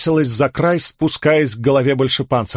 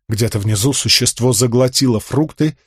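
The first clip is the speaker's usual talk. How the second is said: ordinary delivery, nothing marked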